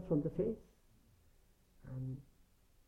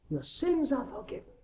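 raised, ringing "Your sins are forgiven"; priestly declaration; 11 semitones higher than usual. Retold or imitated. Minister declaring forgiveness to the confessing sinner, raised pronouncement. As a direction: pronounce it high, like a priest giving absolution. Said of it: "to declare to people on their confession".

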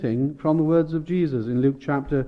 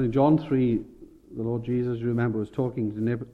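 ordinary talk

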